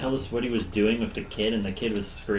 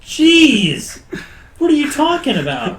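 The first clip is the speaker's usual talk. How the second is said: high, loud, exasperated retort; indignant protest; 11 semitones higher, 8 dB louder, slower than usual. Retold or imitated. Player defending himself to the party over the kid, indignant. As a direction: protest loudly in disbelief, pitch shooting up.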